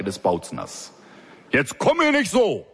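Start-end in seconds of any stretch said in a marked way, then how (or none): 1.5-2.7 high, loud outburst; louder angry yell. A narrator's reading. Boss getting even louder at her voice, yelling. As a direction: blow up at her cheerful tone. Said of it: "deutlichen Anstieg der Lautstärke".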